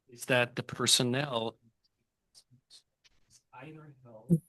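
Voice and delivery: choppy audio dropping out; cutting out mic. Real, not acted. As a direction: voice breaking up on a bad mic. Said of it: "[name], your mic is cutting out again".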